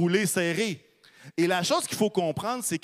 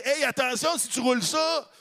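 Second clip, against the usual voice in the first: Martha's warning; 9 semitones higher than usual. Retold or imitated. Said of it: "Marthe, quand elle dit".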